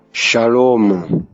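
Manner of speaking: ordinary delivery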